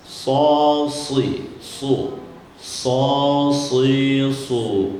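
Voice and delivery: So, si, su with a heavy emphatic s; careful emphatic s syllables; sod drills. Shown as a demonstration.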